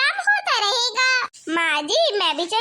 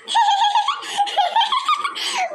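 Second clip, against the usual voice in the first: amused burst of laughter; 8 semitones higher than usual. Acted. Mocking the bride's song.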